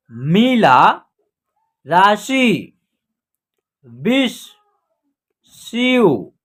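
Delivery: isolated words, vowels drawn out, long pauses between; direct word reading. Shown as a demonstration. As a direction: read each word whole and deliberately, as for beginners. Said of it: "अब direct पढ़ना हम आपको सिखा रहे हैं". (एक-एक शब्द खींचकर, रुक-रुककर पढ़ना)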